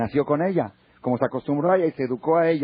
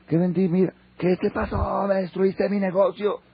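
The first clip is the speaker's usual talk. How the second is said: acted high-pitched outcry of dismay; outraged father; 9 semitones higher than usual. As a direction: cry out as the father finding his business ruined. Father finds his shop destroyed.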